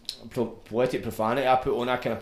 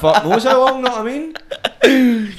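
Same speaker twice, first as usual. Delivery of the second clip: loud, laughing incredulity; cracking up while complaining at volume; laughing rant; 8 semitones higher, 10 dB louder than usual. Real, not acted. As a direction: rant loudly through real laughter.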